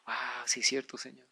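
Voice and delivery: very soft, low admission; broken, contrite. Acted. Husband broken in God's presence conceding to the Lord, contrite. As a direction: give in, voice dropped right down. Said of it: "tú te quebrantas y tú dices".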